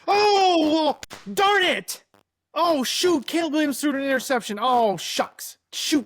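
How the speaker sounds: high-pitched, put-on lament; mock dismay. Podcaster reacting to a rival quarterback's interception, mock dismay.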